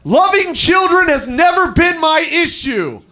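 high, slow shout of frustration; frustrated public outburst at full voice; as loud as possible. Retold or imitated. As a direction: shout it out for the whole hallway. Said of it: "as loud as I fucking can".

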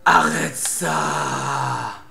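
very slow, stretched-out complaint; drawn-out plea. Real, not acted. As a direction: drag every syllable out. Boss attacking.

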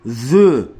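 Zü with a voiced th at the start; tongue between teeth for Zü; lisped Z. Shown as a demonstration. Teaching learners the lisped letter, lisped Zü. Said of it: "dilimizi ısırarak zü diyeceğiz".

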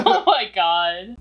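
loud, slow words through laughter; laughing. Real, not acted. Makeup artist reacts to friend's downer apology, laughing. Cracks up at the joke.

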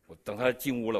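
usual speaking voice

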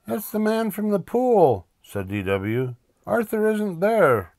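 put-on very high small-girl voice; little sister announcing. Little sister with the phone reporting to Mom, announcing. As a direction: pipe it out high.

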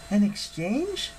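high, slow, questioning echo; AN EXCHANGE as a question, rising at the end; puzzled girl. Acted. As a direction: query it with puzzlement, pitch climbing. Piper repeats Hera's words as question.

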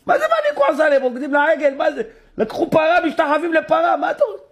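high, incredulous voice of an exasperated God; indignant God. Acted. God answers Moses in a high, outraged squeal.

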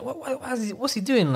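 high, hushed mock indignation; retold fuming thought. Boy silently questioning his teacher's ear-pulling, retold fuming. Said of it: "I'm fuming".

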